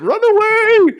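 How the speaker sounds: high, loud Monty Python yelp; hammed-up high yell of run away; mock cry of retreat. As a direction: shriek it like a fleeing knight. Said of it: "this is like Monty Python shit".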